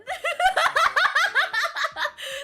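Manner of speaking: loud, high burst of laughter; laughing fit. Real, not acted. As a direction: crack up in a long, loud laugh. Long laugh at prawn stars question.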